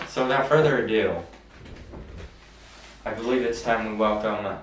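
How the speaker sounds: loud, building announcer welcome; show introduction. Real, not acted. Host bringing on the king of twenty twenty-one, loud announcer introduction. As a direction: announce the guest with volume and lift.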